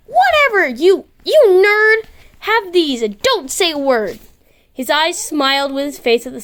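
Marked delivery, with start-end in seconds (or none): 0.1-4.2 flustered outburst. Acted. Flustered Kim brushing off Basil, high and stumbling. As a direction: bluster to hide the embarrassment, slowing on nerd.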